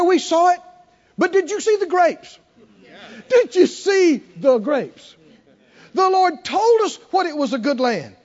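high, eager rallying of the crowd; rally them like a bold young leader; bold Caleb. Retold or imitated. Caleb rallying the scared people, high and eager. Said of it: "Caleb and Joshua got up and said".

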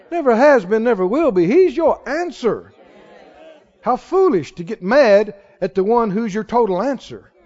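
ordinary delivery

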